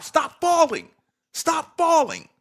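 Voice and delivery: high, loud imitated coach's yell; nonstop yelling. Imagined coach to player, high nonstop yelling. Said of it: "yells at him nonstop".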